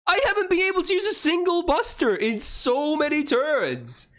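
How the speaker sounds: very high-pitched, outraged rant; frantic exasperation. Real, not acted. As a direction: cry out in disbelief at your rotten luck. Ranting at repeated bad luck.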